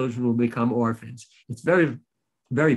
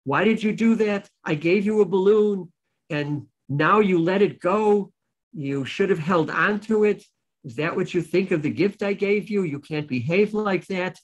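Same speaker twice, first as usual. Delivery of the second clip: put-on higher, scolding the little child over the lost balloon; a store owner's raised scold, imitated; retold yelling; 6 semitones higher than usual. Teacher imitates the store owner yelling at the child, higher and scolding. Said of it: "starts yelling at the child".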